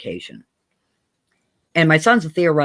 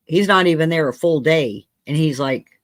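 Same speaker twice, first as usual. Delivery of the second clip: usual speaking voice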